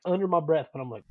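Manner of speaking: ordinary delivery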